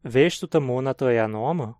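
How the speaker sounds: usual speaking voice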